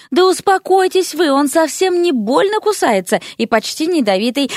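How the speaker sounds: high-pitched, insistent soothing; exasperated reassurance. A narrator's reading. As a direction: insist there's nothing to fear. Поля урезонивает визжащих соседей. (досадливо успокаивая)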